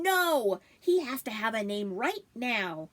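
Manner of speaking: loud unison No; shouted in unison. Acted. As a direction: shout it together, refusing to wait. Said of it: "The boys shouted in unison".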